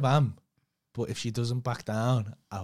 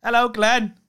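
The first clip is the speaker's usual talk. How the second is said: loud mock greeting; 8 semitones higher, 8 dB louder, slower than usual.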